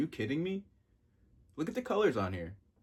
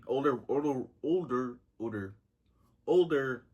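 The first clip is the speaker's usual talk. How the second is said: put-on older repeated, last one louder; building older; 3 semitones higher, 4 dB louder than usual. Shown as a demonstration. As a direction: repeat older, each one stretched more. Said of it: "I'll give you a description".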